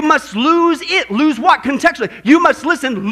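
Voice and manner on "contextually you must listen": ordinary talk, nothing marked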